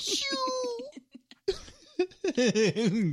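high-pitched whiny wail, drawn out at the end; mock toddler crying. Dad mimics daughter's wail over her dropped shoe, high whiny crying. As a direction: imitate a toddler's weepy "oh no" lament. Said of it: "and she's like".

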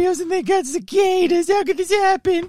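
parroting the co-host's words in a high melodramatic voice; mocking Scott. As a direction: lament it in a shrill mock voice. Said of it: "they came for Cheerios".

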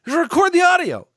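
high-pitched, fast comic outrage; exasperated rant. Acted. Fan scolding the film's sound crew, exasperated outburst. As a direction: rant up high, ending in a loud order.

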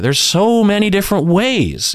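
ordinary talk

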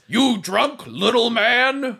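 high, slow golem voice; the door golem quizzes the drunk little man, high and slow. Acted. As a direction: ask like a puzzled big golem. Door golem questions drunk Hawk.

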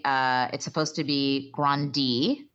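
Grandi said slowly with an EE ending, GRAN-dee. Shown as a demonstration. Correcting the pop star's name for her cohost, GRAN-dee.

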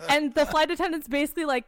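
usual speaking voice

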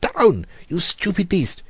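high, loud roar at the beast. A narrator's reading. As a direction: bellow it at the cat. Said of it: "he roared".

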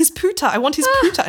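put-on high, insistent child voice; insistent toddler. Toddler insisting to mum, high and childlike. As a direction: demand it like a stubborn three-year-old. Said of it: "And I was like".

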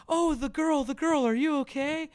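high, loud worried coo; concerned parents. Retold or imitated. Parents cooing over their crying daughter, high and worried. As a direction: fuss over her tearfully. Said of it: "And we're like".

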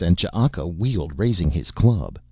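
ordinary delivery, nothing marked

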